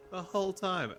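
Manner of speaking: incredulous echo. Retold or imitated. Player mocking a villager's excuse, incredulous echo. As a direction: throw his words back in disbelief.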